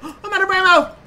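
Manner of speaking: gasp, then cry out in alarm; shocked gasp. Real, not acted. Running out of ammo mid-game, shocked cry.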